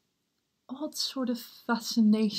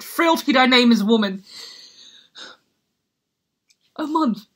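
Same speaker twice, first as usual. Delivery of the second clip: loud, raised cry of contempt; bitter outburst; 3 semitones higher, 9 dB louder than usual. Acted. Hamlet condemning his mother's quick marriage, loud and bitter. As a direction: hurl it out in outrage.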